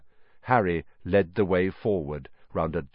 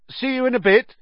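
much higher, louder forced cheer; forced confidence; 7 dB louder, faster than usual. A narrator's reading. Harry leaving Ron alone, forced brave call. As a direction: fake bravado over fear. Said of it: "inject some confidence into his shaking voice".

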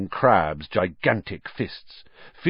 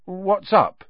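uncertain; 9 semitones higher than usual. Acted. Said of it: "said Ron uncertainly".

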